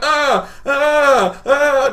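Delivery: high, loud helpless cries; panicked yelps. Retold or imitated. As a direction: yelp frantically while fumbling. Panicking under shouted police orders.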